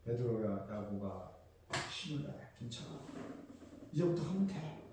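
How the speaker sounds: soft, hushed encouragement; a quiet reassuring master to his disciples; gentle comfort. Pretend. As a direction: pat them on the shoulder and ease them up. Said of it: "툭툭 치면서".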